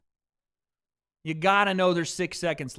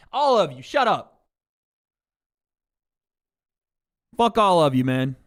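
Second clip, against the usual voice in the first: yelling at chat; 6 dB louder than usual. Real, not acted.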